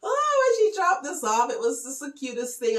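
high, loud, near tears; emotional gush. Real, not acted. Showing viewers a friend's gift, high loud gush near tears. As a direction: let it burst out, choked with feeling.

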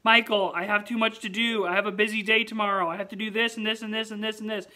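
loud, fast, pitch up, overwhelmed; mock protest. Telling friend Michael he's too busy, loud. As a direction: rattle off your to-do list in a panic. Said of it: "I was like".

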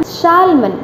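Shalman with the L sounded; wrong salmon, "Shalman", L fully voiced; mispronounced salmon. Shown as a demonstration. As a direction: say "Shalman" with an audible L before the M. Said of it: "I have pronounced the word incorrect".

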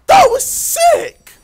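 high, loud, drawn-out rave; ecstatic shout. Real, not acted. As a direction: shriek your approval slowly. Raves after finishing a run.

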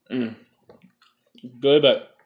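chewing spicy candy; muffled words while eating; mouth full, chewing. Real, not acted. Seen 0: Puts candy in mouth at -1.0, jaw working, grimacing while speaking.

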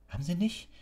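high-pitched outcry of protest; shouting. A narrator's reading. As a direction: cry out against the minister. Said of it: "schrie Harry". (aufgebrachter Schrei, hoch)

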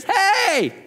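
high, ringing "Hey!" to get attention; crying out loudly. Retold or imitated. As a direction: call out over a crowd, pitch way up. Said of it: "He cries out with a loud voice".

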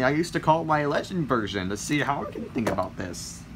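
ordinary talk

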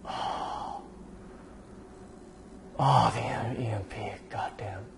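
slow hushed whisper of wonder; awed whisper. Real, not acted. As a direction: breathe it out slowly in awe. Whispered awe at power-up.

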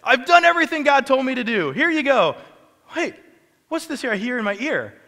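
high, loud mocking sarcasm; sarcastic. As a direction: needle him with fake surprise. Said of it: "this sarcastic little wit".

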